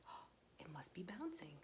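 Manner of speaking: usual speaking voice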